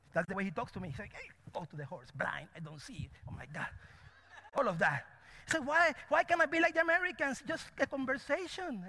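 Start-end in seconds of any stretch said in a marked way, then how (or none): 1.1-3.7 low, hushed aside; muttered dramatic retelling. Retold or imitated. Preacher imitates how God talks to him, low and hushed. 5.5-8.8 high, pleading whine played for laughs; comic plaintive complaint. Preacher asking why he can't be like Americans, comically plaintive.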